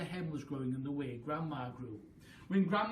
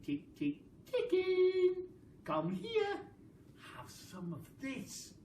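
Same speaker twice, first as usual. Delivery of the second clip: high, sing-song clucking call; coaxing a hen; slower than usual. Acted. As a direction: lure the hen with a sweet call.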